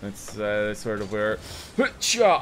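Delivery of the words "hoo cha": high, loud yell on the hit; explosive battle cry. Real, not acted. Gamer striking the ball at the net, explosive yell. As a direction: shout it like a karate strike. Yells while hitting the ball.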